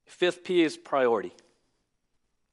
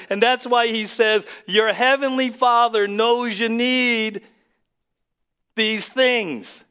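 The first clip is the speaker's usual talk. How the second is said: preaching at full force; 8 semitones higher, 8 dB louder than usual. Acted. Pastor telling the congregation they may call God Daddy, loud preaching. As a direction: proclaim it to the whole church.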